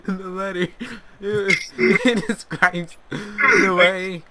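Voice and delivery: high, laughing and breaking up. Real, not acted. [name] retelling the letter while laughing, high and giggly. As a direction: try to talk through helpless giggles.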